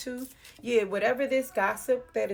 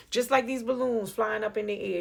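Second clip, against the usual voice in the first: normal talking voice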